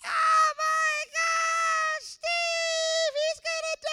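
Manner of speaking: high, loud panicked scream of a frantic mother; panicked mom shout. Retold or imitated. Mom screams in panic over her son, high and loud. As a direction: shriek it like a mom who thinks her son is dying. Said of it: "I remember my mom freaking out".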